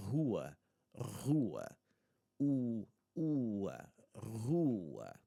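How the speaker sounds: rua with a long, stressed 'oo'; pronunciation demo. Shown as a demonstration. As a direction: model 'rua' slowly, breaking out the 'oo'. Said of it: "the word for street".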